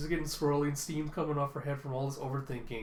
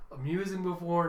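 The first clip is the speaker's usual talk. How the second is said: usual speaking voice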